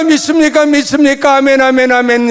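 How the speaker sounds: high, loud call-and-answer; fervent call and response. Retold or imitated. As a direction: mimic a revival crowd. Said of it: "했는지".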